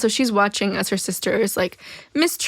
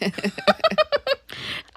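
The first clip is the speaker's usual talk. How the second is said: high laugh. Real, not acted. Laughs at the quote.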